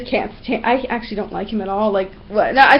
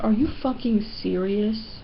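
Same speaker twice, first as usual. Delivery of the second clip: unimpressed disbelief; 7 dB quieter, slower than usual. Retold or imitated. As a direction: drop it soft and slow, fed up. Acted disbelief after the tantrum.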